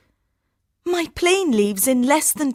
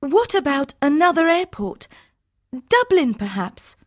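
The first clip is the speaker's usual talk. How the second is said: high, louder urgent question; anxious pleading; 5 dB louder than usual. Acted. Harminder tries to find another flight, anxious. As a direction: grasp at another option.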